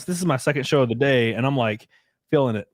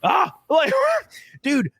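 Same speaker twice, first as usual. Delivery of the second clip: laughing; slower than usual. Real, not acted. Laughing outburst over preference.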